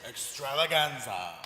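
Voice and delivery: slow, showy low-key reveal; changed tone. Pretend. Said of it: "I change the tone each time".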